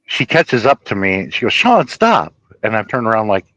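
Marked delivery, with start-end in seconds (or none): 1.5-2.3 high-pitched mock call; imitated girl calling out. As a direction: call after someone in a higher voice. Girl calling after him, retold.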